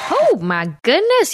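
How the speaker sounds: drawn-out gasp of disbelief at the clip; exasperated disbelief. Real, not acted. Vlogger reacting to Biden's speech in exasperated disbelief.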